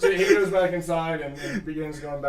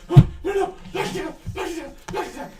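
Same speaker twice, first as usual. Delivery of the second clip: high, urgent backing-off cry; high-pitched frantic plea; panicked NPC; 11 semitones higher, 4 dB louder, faster than usual. Acted. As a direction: wave him off in a high panic. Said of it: "he's like".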